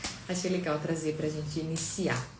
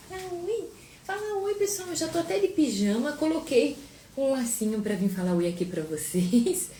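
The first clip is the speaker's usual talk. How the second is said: put-on much higher, cute baby voice speaking as the daughter; voicing the baby; 7 semitones higher than usual. Mother speaking as baby Sofia to the viewers, high cute voice.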